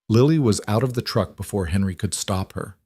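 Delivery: plain reading voice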